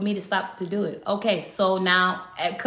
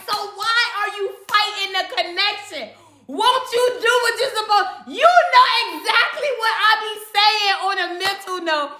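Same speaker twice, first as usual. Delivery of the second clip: mock frantic pleading; 10 semitones higher than usual. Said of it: "I was screaming".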